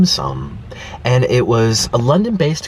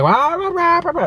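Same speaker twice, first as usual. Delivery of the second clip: high mocking blah-blah chatter; mocking workers' gossip. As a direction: imitate careless chatty workers, pitched up. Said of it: "kinda like".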